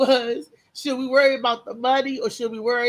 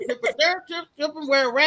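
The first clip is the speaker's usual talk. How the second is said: underwater; 4 semitones higher than usual. Real, not acted. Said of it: "It sound like you on the water".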